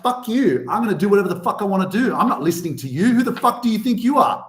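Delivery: put-on fast, defiant teenage outburst; fast defiant teen rant at parents; rebellious teen. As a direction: spit it out like a teen who won't listen. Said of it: "that teen is like".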